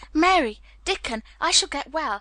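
high, jubilant proclamation; cried out. A narrator's reading. Colin calling Mary and Dickon, cried out joyfully. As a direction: call to them, then rush on elated. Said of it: "he cried out".

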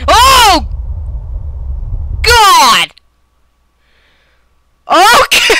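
loud, high yelp of shock; real jump-scare shout; startled scream. Real, not acted. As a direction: scream out in fright. Sudden scare in the game.

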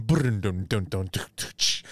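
mouthed drum-and-riff theme tune; theme music imitation. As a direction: sound out the theme with duns and a crash. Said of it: "the theme music plays again".

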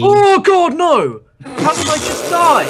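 high shocked cry at dying; shocked outburst. Real, not acted. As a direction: yelp in disbelief, voice jumping up. Just died in game.

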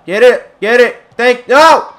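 real gamer shouting at a near miss; excited shout. Real, not acted. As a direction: urge the player on out loud. Shouting at the game.

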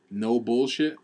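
usual speaking voice

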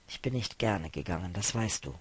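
low, hushed and subdued; soft, deep-pitched reluctant admission. Acted. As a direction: confess it quietly, voice dropped. Reluctant admission in tense exchange.

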